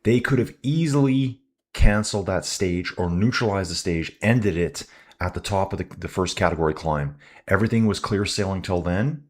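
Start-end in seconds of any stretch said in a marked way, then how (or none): none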